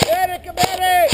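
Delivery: loud urgent call; man down shout. Real, not acted. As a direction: shout for a medic. Calling for medic.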